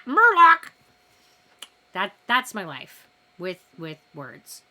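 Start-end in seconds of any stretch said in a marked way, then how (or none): 0.1-0.7 blurted word. Real, not acted. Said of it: "try to say a normal thing".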